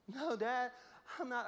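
put-on high, anxious child voice; scared little boy voice. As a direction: play a nervous young son. Scared son answers his dad.

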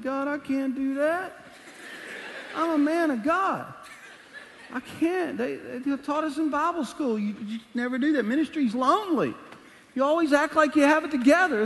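high-pitched, comic pleading with God; hammed-up, flustered objection; panicked protest. Protesting to God's request.

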